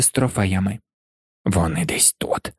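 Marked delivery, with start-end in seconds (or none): 1.4-2.5 low, far-off caretaker grumbling; low grumble. A narrator's reading. Caretaker Filch grumbling while searching the trophy room, low and gruff. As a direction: growl it under the breath, much lower. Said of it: "Почули вони його буркіт".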